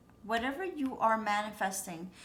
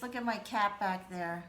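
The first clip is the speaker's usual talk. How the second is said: usual speaking voice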